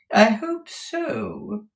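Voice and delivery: high, loud, very slow; drawn-out doubtful madam; haughty Madame Mantalini. Acted. Madam responds to Ralph's assurance, high haughty drawl.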